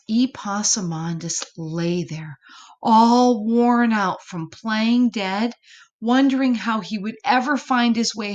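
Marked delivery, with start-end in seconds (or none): none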